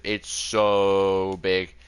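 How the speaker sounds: stretched-out "so" in "it's so big"; drawn-out emphasis. Real, not acted. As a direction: lean hard on "so" and drag it. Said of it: "when I say massive".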